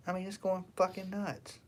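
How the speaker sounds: usual speaking voice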